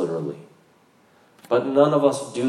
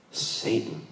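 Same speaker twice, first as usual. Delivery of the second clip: church lady voice; 3 semitones lower, 10 dB quieter than usual. Retold or imitated. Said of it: "Sound like the church lady".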